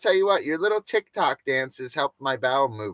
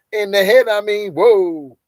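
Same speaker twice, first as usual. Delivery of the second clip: high, loud amazed exclamation; loud stunned reaction; excited whoa; 8 semitones higher, 10 dB louder than usual. Real, not acted. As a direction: stretch the whoa in disbelief. Reacting to headshot memory.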